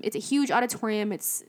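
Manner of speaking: ordinary talk, nothing marked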